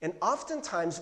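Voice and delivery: normal talking voice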